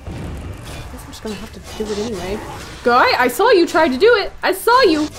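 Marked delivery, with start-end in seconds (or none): 2.8-5.1 loud, high accusing call-out; scolding shout. Real, not acted. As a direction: yell at the clumsy companion. Scolding NPC companion.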